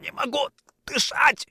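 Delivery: high, strangled gasping words; choked wheeze. A narrator's reading. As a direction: force words out while being choked. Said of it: "хрипел Орлов".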